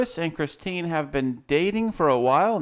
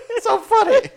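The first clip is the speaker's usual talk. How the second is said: laughing; 6 dB louder than usual. Real, not acted. Cracking up on air.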